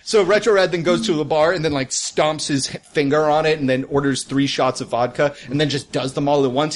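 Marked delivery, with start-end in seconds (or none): none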